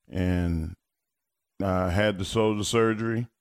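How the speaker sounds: ordinary talk, nothing marked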